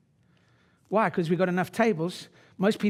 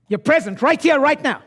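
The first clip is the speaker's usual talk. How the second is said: "You're present right here, right now" higher and louder; 9 semitones higher, 10 dB louder than usual. Shown as a demonstration. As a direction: fire it up at the room. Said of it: "repeats it louder".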